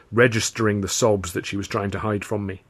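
usual speaking voice

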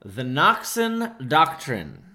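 very slow, weighty announcement with long pauses; solemn presentation. Real, not acted. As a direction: present it like a grand thesis, word by word. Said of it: "the way you're treating it".

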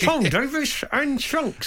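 high, slow dummy voice; ventriloquist act voice. Retold or imitated. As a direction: say it like a vent dummy. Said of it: "You make it sound like a vent act".

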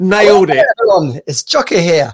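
hammed-up Scottish caller; attempted Scottish accent. Scottish guy ringing up the show, imitated in broad accent. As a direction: greet listeners in a put-on Scottish voice. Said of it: "this Scottish guy rang up".

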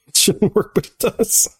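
high giggly aside; laughing. Real, not acted. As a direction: laugh through the line. Laughing aside about faking security.

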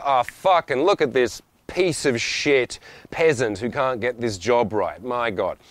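slow, drawn-out exasperated contempt; sneering superiority. Acted. Entitled customer belittling a waiter who messed up, mock disgust. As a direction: act out a snobbish customer's complaint. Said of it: "using my slight level of status".

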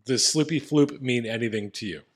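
usual speaking voice

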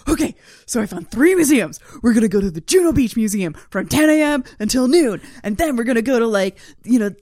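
loud, brisk tour-planner voice, ticking off times; hammed-up overeager itinerary reading; mocking eager husband. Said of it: "He'd be like".

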